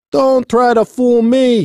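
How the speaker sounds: high, loud, slow scolding; very mad. A narrator's reading. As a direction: scold as an angry foreman, drawn out. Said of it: "He was very mad".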